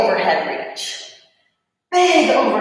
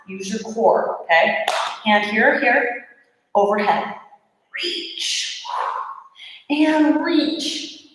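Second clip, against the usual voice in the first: ordinary talk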